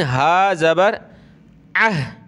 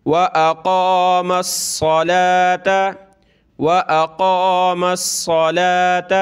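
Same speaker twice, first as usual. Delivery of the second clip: slow chanted recitation; teacher chanting the verse for students; Quran recitation; 2 semitones higher, slower than usual. Shown as a demonstration. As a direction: recite slowly with a melodic lilt.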